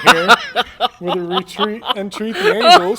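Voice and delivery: rising, laughing hype call; excited shout. Real, not acted. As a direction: yell it as the miracle lands. Big play reveal, laughing.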